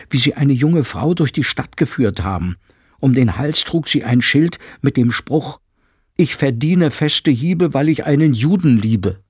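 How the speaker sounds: urgent housekeeper. Acted. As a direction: announce the new law with alarm. Frau Penck retells the humiliation.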